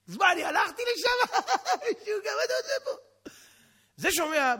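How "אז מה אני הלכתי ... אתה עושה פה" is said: high, cackling ridicule; guard in scornful hysterics; laughing mockery. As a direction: howl it through hammed-up laughter. Guard laughs at own dream.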